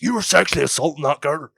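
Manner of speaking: high, loud mock-bouncer accusation. Bouncer accusing a boy about his girlfriend, loud and high.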